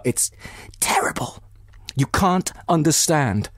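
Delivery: a breaking, hushed despair; fighting back tears. Acted. Dolly near tears telling Anna she can't understand. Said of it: "repressing her sobs".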